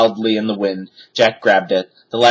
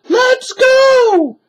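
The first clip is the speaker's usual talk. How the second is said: shout; 6 dB louder than usual. A narrator's reading. Annie calls from treehouse.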